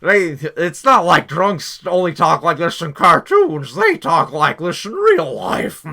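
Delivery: loud, slow, exaggerated drunk voice; hammy cartoon drunk. Mocking the film's barroom drunk, hammed-up drunk act. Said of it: "there's a drunk at the bar".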